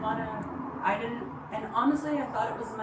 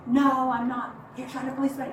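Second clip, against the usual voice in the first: sharp burst, much louder and a bit higher; retold yell; 4 semitones higher, 8 dB louder than usual. Boyfriend refusing to talk, yelled louder. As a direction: throw his refusal back loud. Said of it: "He just was yelling again".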